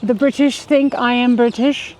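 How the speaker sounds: playfully insistent mock-British; put-on British accent. Wife convincing her doubtful husband, put-on British accent. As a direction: claim to be a born Brit, in the accent. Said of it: "sound absolutely British".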